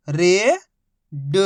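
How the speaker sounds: each syllable held, long pause between; first syllable rising, then a separate second. Shown as a demonstration. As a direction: sound it out for beginning readers. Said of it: "एक ही अक्षर का उच्चारण करके".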